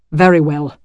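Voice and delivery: loud, curt bark. Acted. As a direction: snap it out loudly. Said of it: "he barked".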